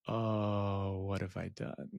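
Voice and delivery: sinking, hushed mock regret; quiet dismay. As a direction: let it drop away in retold dismay. Retold dismay after her horrified reaction.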